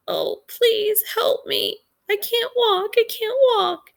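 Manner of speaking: helpless Vern. Retold or imitated. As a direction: play the helpless brother who begs for help. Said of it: "Now he's".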